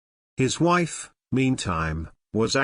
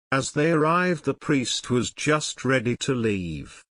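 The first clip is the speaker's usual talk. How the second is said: plain reading voice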